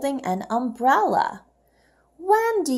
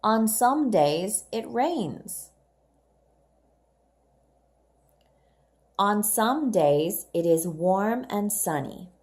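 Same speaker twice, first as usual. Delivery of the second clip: put-on reading aloud to children. Shown as a demonstration. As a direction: read it clearly for young learners. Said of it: "I'll read first, and you can repeat after me".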